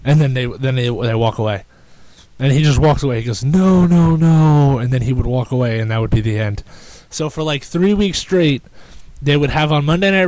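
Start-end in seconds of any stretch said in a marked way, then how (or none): 3.4-4.8 louder insistent no, no, no; mock Fandango waving them off; imitated refusal. Scene: Fandango refusing the announcer, insistent loud no.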